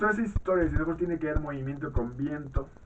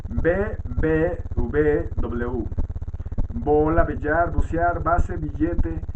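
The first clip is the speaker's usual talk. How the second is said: louder, deliberate word-by-word read-out; dictation-style list reading; 3 semitones higher, 5 dB louder, slower than usual. Acted. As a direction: read out each letter and example like a teacher dictating. Reads letters and example words aloud. (lectura de lista en voz alta, como dictado)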